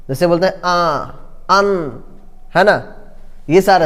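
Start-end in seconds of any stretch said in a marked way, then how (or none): 0.6-2.0 "आ" and "अन" held with air through the nose; nasal vowels. Shown as a demonstration. Teacher demonstrating nasal sounds, "आ" and "अन" nasalized. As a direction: nasalize "आ", then "अन". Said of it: "नाक से भी बाहर निकलता".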